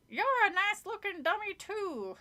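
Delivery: high, harsh rasp of a nasty puppet; raspy dummy taunting a guest. A narrator's reading. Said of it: "in a harsh, raspy voice".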